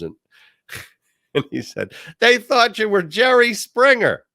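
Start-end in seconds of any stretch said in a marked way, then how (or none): 2.2-4.2 amused cop. Retold or imitated. Cop delivering punchline.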